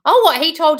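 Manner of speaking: sharp, high, loud "oh what"; startled burst of surprise; shocked. Real, not acted. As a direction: jolt up in real disbelief. Shocked by a viewer's comment.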